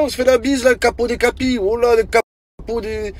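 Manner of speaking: high, hammed-up godfather greeting; mock the cheek-kissing capo; mock mafia boss. Guy mocking friends as Sicilian godfathers.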